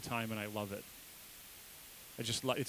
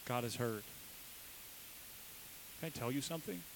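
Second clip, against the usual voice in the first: hushed sincere; 8 dB quieter than usual. Real, not acted. Intimate sermon point.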